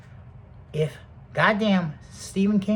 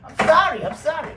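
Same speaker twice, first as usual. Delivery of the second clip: mock pleading; 12 semitones higher, 5 dB louder than usual. Acting out apology after slap.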